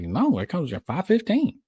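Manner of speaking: high, slow mock Southern drawl; Southern accent. Southerner answering about the train, high slow Southern accent. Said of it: "you ask a Southern".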